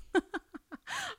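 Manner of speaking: high breathy laugh; high giggle. Real, not acted. Reacting to giggles, high laugh. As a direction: giggle at the word.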